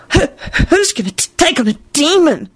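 stammering, high-pitched demand. A narrator's reading. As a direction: stutter out the demand. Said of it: "Melvin demanded".

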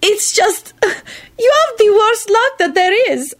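very high, tickled voice; giggling. A narrator's reading. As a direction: say it as if fighting giggles. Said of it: "she giggled".

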